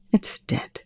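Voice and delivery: soft, whispered report; low-key, breathy statement; hushed. A narrator's reading. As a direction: whisper it grimly. Grim whispered report over a body.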